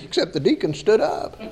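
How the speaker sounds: ordinary talk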